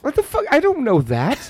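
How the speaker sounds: a baffled high yelp at the reference; incredulous. Real, not acted. Baffled by Party of Five, a high incredulous yelp.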